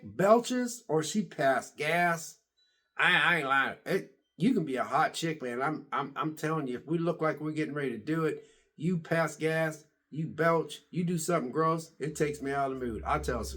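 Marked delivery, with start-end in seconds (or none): none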